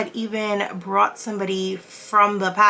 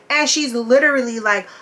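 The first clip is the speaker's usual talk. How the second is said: ordinary talk, nothing marked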